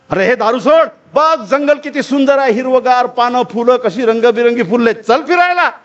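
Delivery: eager coaxing rabbit. Acted. Rabbit urging a drinking wolf to come roam the jungle, eager coaxing. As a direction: coax brightly, lifting the pitch.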